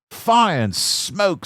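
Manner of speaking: high, loud, slow exclamation; dismayed cry. A narrator's reading. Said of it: "said Thomas".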